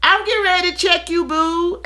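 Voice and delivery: quick, high, sassy reply; friend's comeback. Retold or imitated. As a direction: fire it back fast. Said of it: "my friend would be like".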